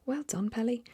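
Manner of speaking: high, loud praise; cheering cry. A narrator's reading. Giraffe praising the pelican, high loud cheer. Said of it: "cried the Giraffe".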